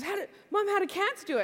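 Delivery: lightly voiced inquisitive kid; curious child. Daughter to mom on seeing the cat, curious.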